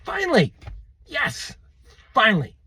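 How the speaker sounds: "finally" leaping high in delight; the soul cries out with joy at last; joyful relief. Pretend. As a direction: exhale it like a soul set free. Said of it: "your soul's finally gonna say".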